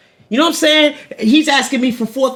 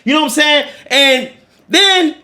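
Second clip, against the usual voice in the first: ordinary talk, nothing marked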